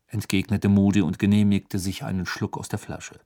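usual speaking voice

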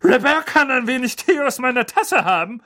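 high, loud overbearing aunt; Aunt Magda. Acted. Aunt answering her brother about her dog, overbearing. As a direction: lord it over the kitchen. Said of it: "sagte Tante Magda".

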